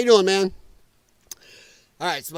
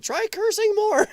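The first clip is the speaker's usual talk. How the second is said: mocking voice; 14 semitones higher than usual. Shown as a demonstration. Streamer parodying the Democrat quote, high mock voice. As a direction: try cursing more rising much higher in mockery. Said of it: "We're polling at twenty-seven percent".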